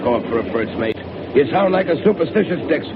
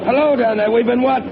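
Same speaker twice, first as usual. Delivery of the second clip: calling out; 9 semitones higher than usual. Acted. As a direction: shout it down below, demanding an answer. Calling again to engine room.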